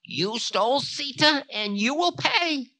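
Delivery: very high accusing threat; Time, threatening. A narrator's reading. Time accusing Ravana of stealing Sita, threatening. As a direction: pitch the accusation right up high.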